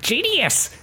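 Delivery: high breathy witch exclamation. Retold or imitated. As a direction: squeal it like a cackling witch. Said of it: "The witches are like".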